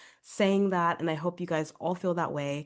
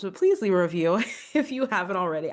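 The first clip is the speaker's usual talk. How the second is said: louder, quick request broken by laughter; laughing plea; 3 semitones higher, 7 dB louder, faster than usual. Real, not acted. Podcaster repeats her request to listeners, laughing.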